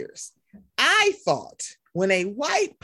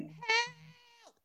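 high, thin mock wail, much softer; guy crying for help; 13 semitones higher, 11 dB quieter than usual. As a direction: draw out a distant-sounding, hammed-up cry. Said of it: "I'm like that guy in the".

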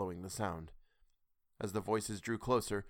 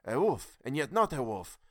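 shuddering; 4 semitones higher, 4 dB louder than usual. A narrator's reading. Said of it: "another put in shudderingly".

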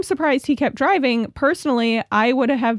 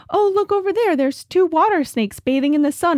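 high, chirpy imitation of a passerby; 7 semitones higher than usual. Woman with dog points out water snakes, chirpy and high. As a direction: point out a sight in a high, sing-out voice.

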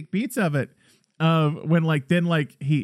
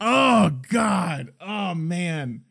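pained movie reaction; 3 semitones higher, much slower than usual. Retold or imitated. Reliving a brutal stomping scene.

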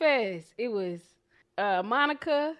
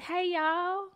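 higher, sing-song Southern belle greeting; Southern girl voice; 7 semitones higher than usual. Shown as a demonstration. Host demos her Southern girl voice for Europe, higher sing-song. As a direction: coo it like a sweet Southern girl charming Europeans. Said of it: "That's my Southern girl voice".

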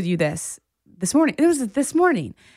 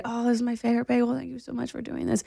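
higher, softer, quick thanks; boyfriend voice; 4 semitones higher, 6 dB quieter, faster than usual. Retold or imitated. Boyfriend thanking her for the bagel, lightly voiced. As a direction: play the grateful boyfriend lightly.

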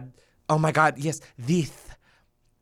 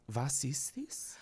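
soft, slow mock German accent; 15 dB quieter, slower than usual. Shown as a demonstration. Host tries a German accent for the first time, softly. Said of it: "I wanna try doing my German accent".

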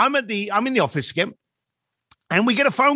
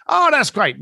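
bright, high, eager "oh, that's great"; matching enthusiasm; 6 semitones higher, 3 dB louder than usual. Shown as a demonstration. A negotiator on the phone echoing the caller's excitement. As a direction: mirror the caller's excitement back at him. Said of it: "you marry the enthusiasm that they're giving you".

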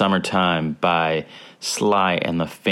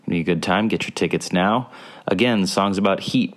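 ordinary delivery

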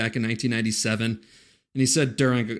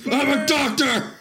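put-on drunken rant; 13 semitones higher, 4 dB louder, slower than usual. Mimicking the raging drunk friend.

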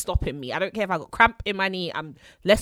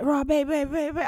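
higher, put-on voice for the boyfriend; hammed-up imitation of his babe babe; mock boyfriend voice; 7 semitones higher than usual.

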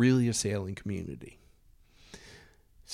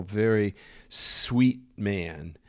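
usual speaking voice

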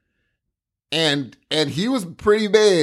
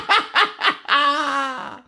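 high burst of laughter; 9 semitones higher than usual. Real, not acted. Friend laughs at the coffee-only breakfast, high laughter.

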